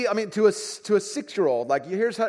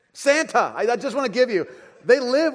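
laughing; 7 semitones higher, 5 dB louder than usual. Real, not acted. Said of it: "laughing at the answer".

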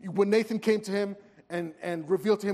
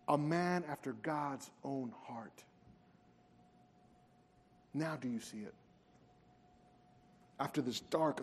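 hushed preaching; 7 semitones lower, 11 dB quieter than usual. Acted. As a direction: lean in and let it land quietly. Quiet reflective sermon climax.

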